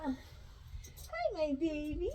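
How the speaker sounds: high, soft coo to the dog; tender pet-owner greeting. Real, not acted. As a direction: coo hello to your puppy.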